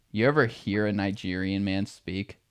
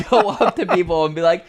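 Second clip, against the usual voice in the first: ordinary delivery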